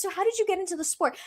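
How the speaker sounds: high, quick imitation of friendly interest; retold sincere question, high and brisk; genuinely curious. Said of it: "the way he asked it actually was genuine".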